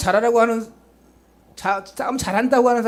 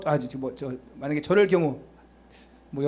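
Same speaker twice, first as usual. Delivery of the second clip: ordinary talk, nothing marked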